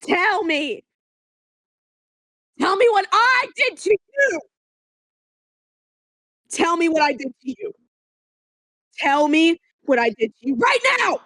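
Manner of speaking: sobbing, furious demands; crying shout. Real, not acted. Girlfriend demanding answers, shouting and crying. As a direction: yell it through tears.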